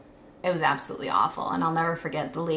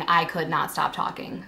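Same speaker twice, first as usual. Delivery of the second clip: ordinary talk, nothing marked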